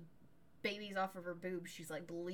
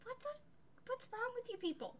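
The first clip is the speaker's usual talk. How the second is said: incredulous outrage; faster than usual. Real, not acted. Outraged at the family.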